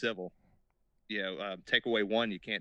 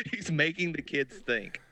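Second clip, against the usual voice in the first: higher, slow, through laughter; laughing; 7 semitones higher, slower than usual. Real, not acted. Listener repeating the teacher's complaint, laughing. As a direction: repeat it while cracking up.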